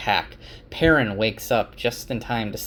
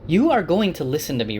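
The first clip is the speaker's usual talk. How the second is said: louder, higher and fast; insistent; 7 semitones higher, 5 dB louder, faster than usual. A narrator's reading. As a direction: demand he listen. Egwene insisting, confronting Rand.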